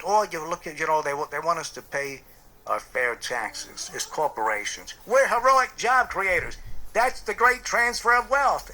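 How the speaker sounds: pompous corporate boaster, getting loud; narcissist voice. Retold or imitated. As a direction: puff up like a self-praising tycoon. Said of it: "that's like a narcissist talking to you".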